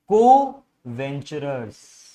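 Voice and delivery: low, stretched-out को-वेंचरर्स, each part held; slow, deliberate term. Real, not acted. Teacher introduces the new term slowly.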